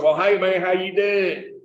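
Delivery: broad, drawn-out rural Kentucky drawl; relatives' country accent. Retold or imitated. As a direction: greet them in a thick country accent. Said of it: "That's how my relatives talk".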